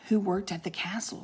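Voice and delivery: hushed whisper. Acted. Annie confiding about old Maggie, whispering. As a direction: breathe it like a secret.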